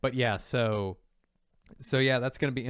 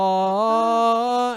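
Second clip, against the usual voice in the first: much higher and louder drawn-out "oh"; mock startled cry; 11 semitones higher, 10 dB louder than usual. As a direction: yelp it like the meme character. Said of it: "and he's like".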